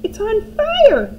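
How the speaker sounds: high, loud, slow alarmed yell; grandma yelling about the burning hen. A narrator's reading. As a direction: cry out in an old woman's voice. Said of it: "Grandma yelled".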